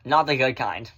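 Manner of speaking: normal talking voice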